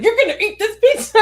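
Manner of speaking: put-on high, loud laughing shout at a picky kid; half-laughing mock yell; mock angry yell. Mom retelling yelling at her daughter, high loud laughing shout.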